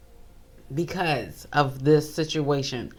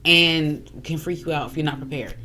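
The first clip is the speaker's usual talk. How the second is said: usual speaking voice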